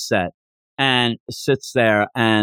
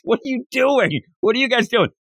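surprised; 10 semitones higher than usual. Retold or imitated. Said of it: "Lloyd goes".

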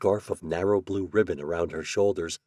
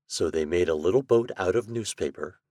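plain reading voice

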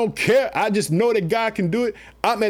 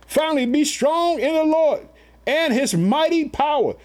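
scripture proclaimed again; 6 semitones higher than usual. Acted. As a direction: drive the verse home a second time. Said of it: "Did you hear that?".

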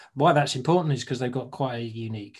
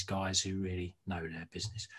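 whisper; 5 semitones lower, 13 dB quieter than usual. Real, not acted. Aside about rum masters.

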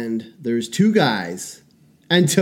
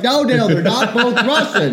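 laughing, high correction; mock correction; 9 semitones higher than usual. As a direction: imitate coworkers correcting you, amused. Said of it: "And they're like".

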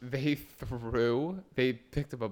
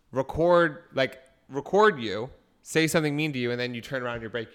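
ordinary talk

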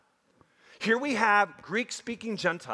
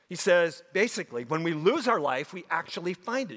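ordinary delivery

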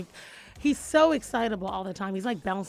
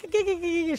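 mock giggle; 9 semitones higher than usual. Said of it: "She's just laughing like".

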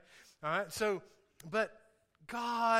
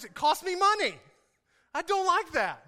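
mock complaint; 8 semitones higher, 6 dB louder than usual. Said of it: "joking complaint about wife".

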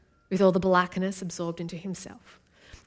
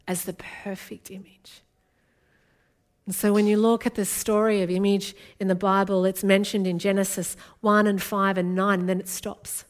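usual speaking voice

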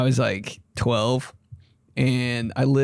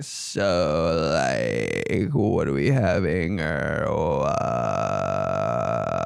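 much lower croaky voice; sick voice; 11 semitones lower, much slower than usual. Shown as a demonstration. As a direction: rasp it out like you're sick. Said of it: "when I'm sick".

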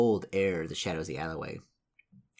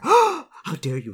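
high, loud, slow indignation; gasp, then exclaim in scandalized offence; hammed-up shocked outrage; affronted outrage; 8 dB louder, much slower than usual. Character gasps, affronted by the ring.

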